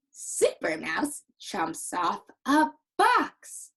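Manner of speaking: slow, loud, punched-out happy reading; big happy read-along voice; excited and happy. Shown as a demonstration. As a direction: lift the last word with excitement. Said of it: "I have to use an excited and happy voice".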